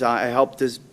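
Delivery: ordinary talk, nothing marked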